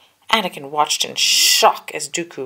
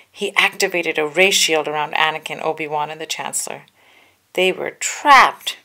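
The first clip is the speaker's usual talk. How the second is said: usual speaking voice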